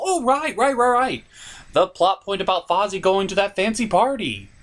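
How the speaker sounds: ordinary talk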